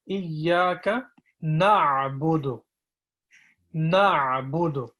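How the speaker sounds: slow "Iyaka na'budu", throaty "a" stretched; slow deliberate recitation. Shown as a demonstration. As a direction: take it slowly to give the throat time. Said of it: "slow down".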